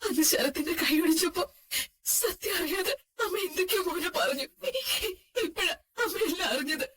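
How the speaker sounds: sobbing, remorseful apology; crying. Acted. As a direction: confess the mistake between sobs. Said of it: "ഇങ്ങനെ കരയുന്നത് നിർത്ത്".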